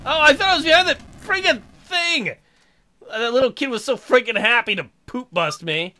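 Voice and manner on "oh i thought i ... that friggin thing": high loud indignant outburst; indignant surprise. Real, not acted. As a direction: protest loudly at being spotted. Caught while hiding.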